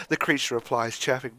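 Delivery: usual speaking voice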